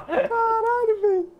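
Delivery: high, slow laughing exclamation. Real, not acted. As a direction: laugh through the swear. Laughing at a kill.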